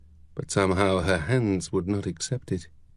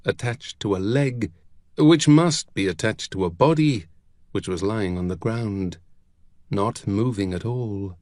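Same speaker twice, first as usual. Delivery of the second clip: usual speaking voice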